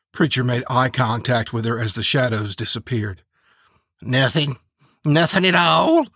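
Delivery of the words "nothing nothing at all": giggly denial, rising louder and higher; loud giggle. Acted. Preacher brushing off Turtle Dove's question, loud and giggly. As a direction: say it through a giggle, climbing at the end. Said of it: "he said with a loud giggle".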